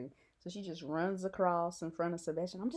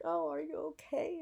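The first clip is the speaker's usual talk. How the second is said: concerned kind man; 10 semitones higher than usual. Retold or imitated. Quoting kind Sebastian's concern.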